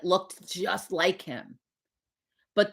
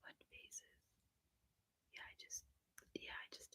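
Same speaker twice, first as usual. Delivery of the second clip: whispering; 33 dB quieter than usual. Real, not acted. Muttering to herself on the app.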